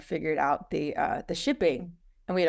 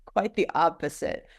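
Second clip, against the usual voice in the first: ordinary talk, nothing marked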